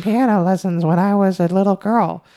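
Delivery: put-on high, frail old woman voice; old lady voice. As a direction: play an elderly lady reminiscing. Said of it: "That's my old lady voice".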